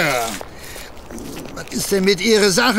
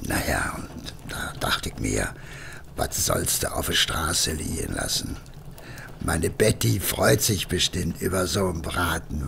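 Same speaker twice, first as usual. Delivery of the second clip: much lower and softer excuse; sheepish hush; 13 semitones lower, 9 dB quieter than usual. Acted. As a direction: mumble the excuse guiltily. Sheepish confession to wife.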